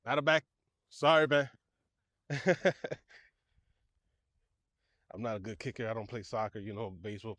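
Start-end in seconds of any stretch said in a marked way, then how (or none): 0.1-1.5 loud call across field. Real, not acted. Calling to kid after bad kick. 2.3-3.2 embarrassed laugh. Real, not acted. Laugh after bad kick.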